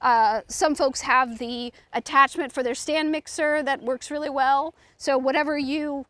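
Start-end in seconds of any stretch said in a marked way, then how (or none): none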